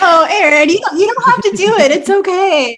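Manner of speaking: high, loud laughing plea; laughing protest. Real, not acted. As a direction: begs her friend to stop, half laughing. Pleading Erin not to confess.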